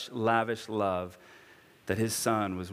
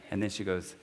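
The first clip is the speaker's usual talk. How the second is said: ordinary talk, nothing marked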